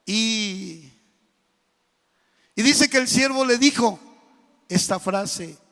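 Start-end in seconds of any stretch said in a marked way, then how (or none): none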